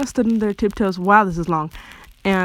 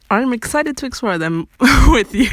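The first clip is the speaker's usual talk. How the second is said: laughing through the line, louder and higher; amused; 5 semitones higher, 7 dB louder than usual. A narrator's reading. Lepis eager to explore with a friend, read laughing. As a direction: break into laughter while reading. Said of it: "Lepis said homosexually".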